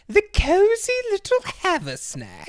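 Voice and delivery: high, loud, appalled repeat; disgusted Andora. Retold or imitated. Mother repeating the diner's name in high disgust. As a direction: spit the name back in high disdain. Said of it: "She is disgusted".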